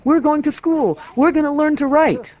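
high, loud imitation of excited schoolgirls; excited squealing. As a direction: squeal it out like thrilled little girls. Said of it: "squealing with excitement".

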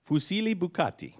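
rolled Italian pasta name; Italian accent. Pretend. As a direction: lean into the Italian. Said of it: "Your Italian accent is phenomenal".

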